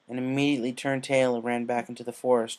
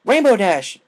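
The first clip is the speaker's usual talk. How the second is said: much louder, higher sharp yell; 9 semitones higher, 13 dB louder than usual. A narrator's reading. Applejack yells Rainbow out of nightmare. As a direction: snap her out of it. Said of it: "Applejack yelled".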